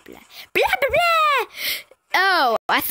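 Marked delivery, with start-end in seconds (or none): 0.1-1.5 blahs squealed way up high; high nonsense babble. Pretend. Same babble, thrown up high.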